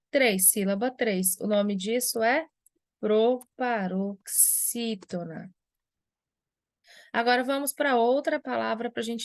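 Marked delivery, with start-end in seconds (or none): 3.0-5.5 proparoxítona drawn out slowly, syllable by syllable. Shown as a demonstration. Teacher naming the class of word for students, slow syllable by syllable. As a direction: stretch out each syllable of "proparoxítona".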